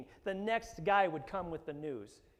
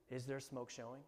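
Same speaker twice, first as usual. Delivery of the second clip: asked again, much softer; softer repeat; 2 semitones lower, 7 dB quieter than usual. Shown as a demonstration. Preacher repeating the question to the congregation, quiet and soft. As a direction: let the question sink in quietly.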